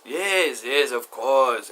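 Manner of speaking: drawn-out, loud acted yeses; loud insistence. Friend assuring a doubter it's his house, loudly insistent. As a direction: insist loudly, stretching the words out.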